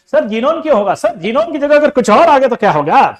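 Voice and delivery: high, loud pestering student; whiny student. Retold or imitated. Student nagging teacher, loud whiny voice.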